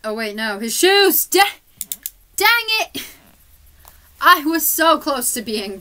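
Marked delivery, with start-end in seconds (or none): 0.6-3.0 loud, high, sudden dismay; frustrated exclamation. Real, not acted. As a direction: yelp in frustration. Forgot painting shoes.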